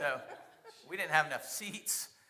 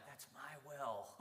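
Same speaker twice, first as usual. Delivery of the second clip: slow inner thought; 10 dB quieter, slower than usual. Retold or imitated. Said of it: "In my mind, I'm like".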